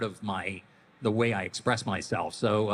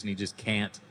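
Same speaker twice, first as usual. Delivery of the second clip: normal talking voice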